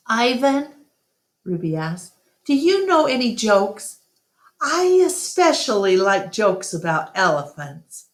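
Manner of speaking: put-on high, eager little elephant girl; young Ruby voice. Narrator voices little Ruby asking Ivan. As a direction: ask like a curious child.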